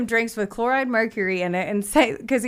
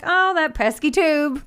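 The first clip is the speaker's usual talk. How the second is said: mock innocent; 7 semitones higher, 4 dB louder than usual. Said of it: "was like".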